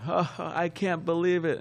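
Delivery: put-on drunk laughing guru. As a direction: play the drunk guru cracking up at his success. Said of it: "And he's laughing".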